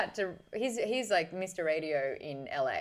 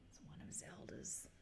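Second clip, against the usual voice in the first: barely audible whisper; whispered aside; 5 semitones lower, 23 dB quieter than usual. Real, not acted. Streamer repeating the hair remark to herself, whispered. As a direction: whisper it to yourself.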